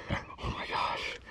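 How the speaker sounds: slow, soft whisper of awe; stunned whisper. Real, not acted. Angler staring at his giant catch, stunned whisper. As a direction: whisper it slowly, overwhelmed. Overwhelmed after landing the giant.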